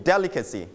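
"Delicacy" stressed on DEL, pitch falling after; stress on first syllable. Shown as a demonstration. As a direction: model "Delicacy" with a strong DEL. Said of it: "Straight on the first syllable". (ลงเสียงหนักที่ DEL พยางค์แรกของ "Delicacy")

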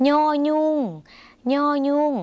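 ย่อยุ่ง held high and level with a nasal onset; nasal pronunciation. Shown as a demonstration. Teacher demonstrating the Lao letter to viewers, nasal ย่อยุ่ง. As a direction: say ย่อยุ่ง slightly through the nose, high-pitched. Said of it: "เสียงขึ้นจมูกนิดนึงนะคะ".

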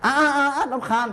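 put-on high, slow whining child voice; whiny child. Monk voicing a child whining at his parent. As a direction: drag it out like a fed-up kid.

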